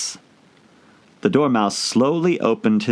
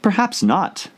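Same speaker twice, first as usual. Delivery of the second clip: cautious; 12 semitones higher, slower than usual. A narrator's reading. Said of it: "Alice cautiously replied".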